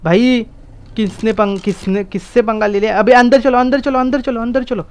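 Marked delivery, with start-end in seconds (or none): 3.0-4.9 higher, repeated orders tumbling out; urgent command. Real, not acted. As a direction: herd teammates inside under attack. Herding team inside during attack.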